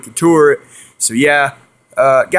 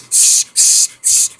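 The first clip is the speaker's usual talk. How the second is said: short repeated hisses; turtle hissing; much slower than usual. Retold or imitated. Storyteller imitates the turtle being picked up, hissing. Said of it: "it was hissing".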